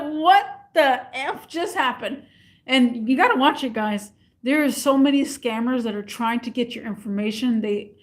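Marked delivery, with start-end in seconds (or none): 0.0-2.2 baffled. Retold or imitated. After the call.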